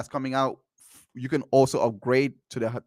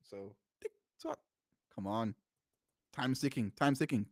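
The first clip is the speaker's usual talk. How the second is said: muttered under breath; 2 semitones lower, 13 dB quieter than usual. Real, not acted. Waiting for her, muttering.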